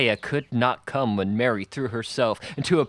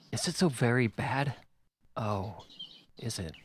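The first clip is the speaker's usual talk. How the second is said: softer, anxious, fading to a near hush; frightened plea; 5 dB quieter than usual. A narrator's reading. Mother begs young officer for news, fearful and soft. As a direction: plead for reassurance, dropping away. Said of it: "Mary heard her say".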